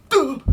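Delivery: high, loud effort cry on a jump; loud play-acted leaping grunt; punchy high hop sound; pretend jump noise.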